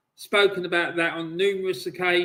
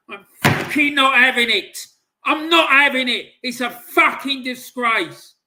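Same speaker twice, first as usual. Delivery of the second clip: louder, higher furious shouting, punched out; real furious rant; 4 semitones higher, 7 dB louder than usual. Real, not acted. Fan raging at the club, furious shout. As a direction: spit it out in real outrage. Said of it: "I am so fucking angry".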